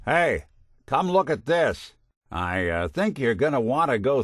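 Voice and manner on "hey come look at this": higher-pitched beckoning call; calling over. Acted. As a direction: get a companion's attention. Calling companion over to look.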